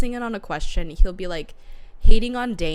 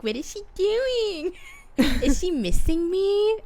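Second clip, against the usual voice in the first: high, slow pining questions; hammed-up longing for her; mock lovesick whine; 9 semitones higher, slower than usual. Friend imitating Xaden pining for Violet, high and slow. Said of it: "so fucking funny when he's just like".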